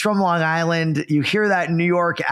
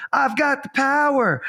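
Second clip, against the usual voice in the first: lightly mimicked catchphrase, pitched up; imitated sound clip; 7 semitones higher than usual. Retelling the button Taylor hit on the computer, lightly mimicked. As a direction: mimic the clip with a higher lift.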